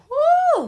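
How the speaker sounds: sudden high preacher whoop; preacher's whoop. Acted. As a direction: whoop like a preacher mid-sermon. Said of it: "like I'm preaching this thing".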